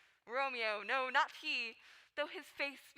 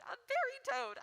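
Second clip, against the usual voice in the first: squeaky disgust; 11 semitones higher than usual. Acted. Nurse mocks Paris suitor.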